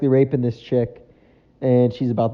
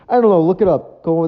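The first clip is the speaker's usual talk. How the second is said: exasperated; 5 semitones higher, 6 dB louder than usual. Real, not acted. Exasperated at forgetting the joke.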